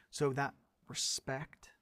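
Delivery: ordinary talk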